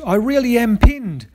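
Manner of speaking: high desperate screech. A narrator's reading. Cockatoo pinned by the dingo pleading with the birds, high screech. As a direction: screech it, begging to be believed. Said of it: "Cocky screeched".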